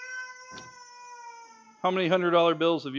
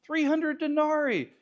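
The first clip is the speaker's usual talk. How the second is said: Judas voice; 10 semitones higher than usual. Retold or imitated. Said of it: "So Judas says".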